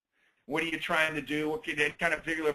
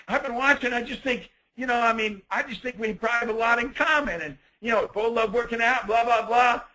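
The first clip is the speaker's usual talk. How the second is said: higher, overeager pickup line; creepy pushy guy; 7 semitones higher than usual. Acted. Man pressing a woman at the gym, creepy and pushy. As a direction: play the guy who won't take the hint. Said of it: "Now it is creepy".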